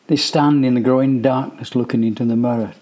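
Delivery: ordinary delivery, nothing marked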